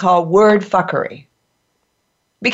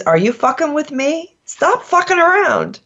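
high-pitched example of indignation; play-acted annoyed demand; mock confrontational; 8 semitones higher than usual. Shown as a demonstration. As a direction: challenge them in a raised pitch. Acting out example phrases.

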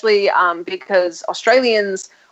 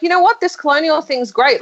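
high-pitched, lightly acted eagerness; mock colonial enthusiasm; 6 semitones higher than usual. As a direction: play at being keen on a scheme. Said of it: "We were just like".

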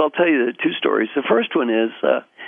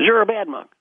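high-pitched accusing voice; lightly acted angry wake-up scolding; mock angry; 9 semitones higher than usual. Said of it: "his colleague is still angry".

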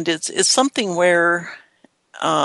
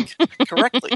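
higher, laughing delivery; 7 semitones higher than usual. Real, not acted. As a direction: let real laughter break into the words. Joking reply amid shared laughter.

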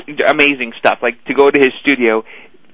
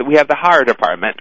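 horror said as "haw-ruh" with a Boston accent; Boston accent on the word horror. Shown as a demonstration. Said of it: "practicing on how to say horror".

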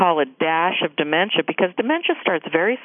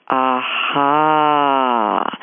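low, drawn-out aha; a dawning aha of insight; realizing aha; 4 semitones lower than usual. Retold or imitated. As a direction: sound the moment it clicks. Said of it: "I would have gone".

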